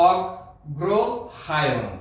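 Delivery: অগ্রহায়ণ drawn out very slowly with a doubled g; অগ্রহায়ণ spoken slowly for children to copy; pronunciation demo. Shown as a demonstration. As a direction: say অগ্রহায়ণ as ogg-ro-ha-yon, very slowly. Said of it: "উচ্চারণটি হবে".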